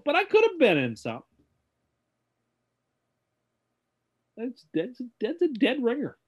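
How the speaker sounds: high-pitched, halting actor impression; odd pauses in a put-on high voice; hammy celebrity impression, stopping and starting; Christopher Walken. Shown as a demonstration. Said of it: "Can we hear Christopher Walken?".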